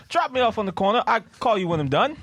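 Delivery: high-pitched put-on British accent. Shown as a demonstration. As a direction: do a cheeky, high British chap. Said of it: "we're gonna hear [name]'s British accent after".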